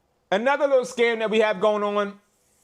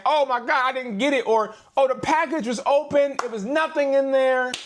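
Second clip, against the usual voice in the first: mock customer; 8 semitones higher than usual. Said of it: "people are saying".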